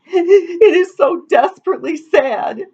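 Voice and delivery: very high tearful wail; sobbing. A narrator's reading. Weeping daughter explains her grief, sobbing. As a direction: wail it like a storybook crybaby. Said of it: "sobbed Amity".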